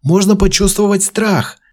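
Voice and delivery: high, loud triumphant shout. A narrator's reading. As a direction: blurt out the answer. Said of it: "закричал он".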